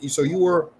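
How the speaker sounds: usual speaking voice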